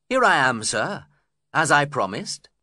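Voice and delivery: loud, bright-voiced hearty greeting; cheerfully. A narrator's reading. Lockwood greeting Heathcliff cheerfully on arrival. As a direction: greet the host brightly, pleased to be there. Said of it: "I said cheerfully".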